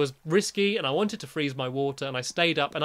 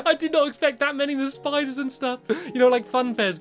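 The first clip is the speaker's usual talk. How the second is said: excited; 10 semitones higher, 4 dB louder than usual. Real, not acted. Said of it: "That was terrifying".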